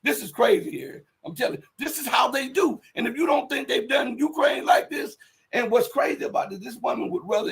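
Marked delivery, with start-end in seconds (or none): none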